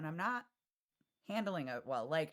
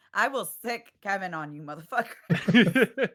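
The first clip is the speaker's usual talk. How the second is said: laughing threat; 4 semitones higher, 10 dB louder, slower than usual. Real, not acted.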